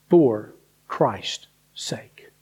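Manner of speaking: slow, hushed whisper; very slow breathy hush. Real, not acted. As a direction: let the phrase fall to a reverent whisper. Reading scripture, drops to a hush.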